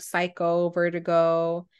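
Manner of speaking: usual speaking voice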